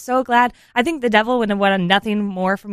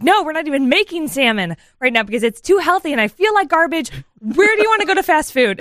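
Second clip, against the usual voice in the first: high-pitched, fed-up rant; retold exasperation; 7 semitones higher than usual. Frazzled mother answers husband about dinner, exasperated and high. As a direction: complain in a rising, worked-up mock tirade.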